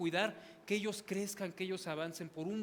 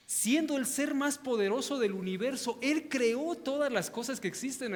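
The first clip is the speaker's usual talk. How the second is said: louder, ringing preacher's proclamation; preaching; 6 dB louder than usual. Acted. Pastor exhorts the congregation about God's character, preaching louder. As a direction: proclaim God's greatness with rising force. Preaching rises to full force.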